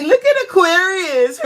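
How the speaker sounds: high, loud laughing call; imitating sister cracking up. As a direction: mimic the sister laughing hard. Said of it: "She was like".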